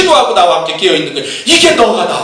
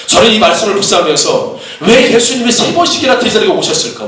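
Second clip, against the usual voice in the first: normal talking voice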